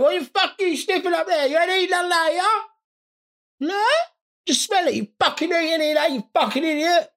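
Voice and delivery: very high-pitched cartoon squeal; screeching bumhole. Outraged bumhole protests the chilli sauce, screeching. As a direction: squeal indignantly in a tiny put-on voice. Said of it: "your bumhole started shouting ... Bumhole just screeching".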